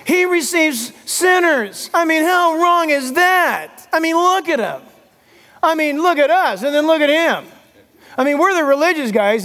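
mock whining impression of the Pharisees; mocking whiny Pharisee. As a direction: whine it like a smug religious man griping. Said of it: "they're like this".

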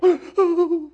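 high, mock-startled gasp; jolted intake of breath, played up; frightened gasp. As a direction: gasp as if just spooked. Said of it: "And you and I go".

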